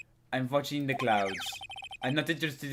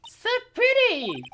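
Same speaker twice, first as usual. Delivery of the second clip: put-on gushing girl; 5 dB louder, slower than usual. Voicing gushing Maya.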